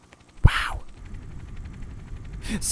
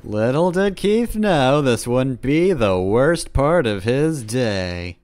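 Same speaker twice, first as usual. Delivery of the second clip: slow, loud storyteller voice; put-on narration, big and slow; mock dramatic narrator; 3 semitones higher, 4 dB louder, slower than usual. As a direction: narrate it like ominous foreshadowing, drawn out. Mock narrator over the game.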